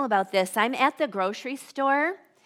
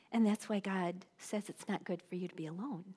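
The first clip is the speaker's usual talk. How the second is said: ordinary delivery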